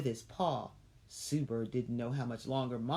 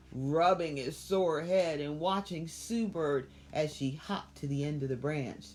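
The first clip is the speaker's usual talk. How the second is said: usual speaking voice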